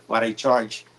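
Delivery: ordinary talk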